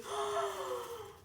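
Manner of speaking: sharp, high gasp of surprise. Real, not acted. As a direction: catch your breath up high, stunned. Seeing a special gift.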